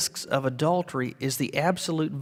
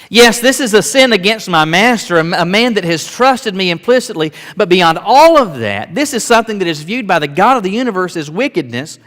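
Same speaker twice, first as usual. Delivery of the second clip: full-force preaching; 4 semitones higher, 9 dB louder than usual. Real, not acted.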